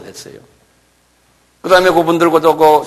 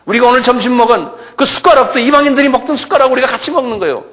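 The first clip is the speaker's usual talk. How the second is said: preaching at full force; 12 semitones higher than usual. Acted. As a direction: drive the point home to the congregation. Fiery sermon passage.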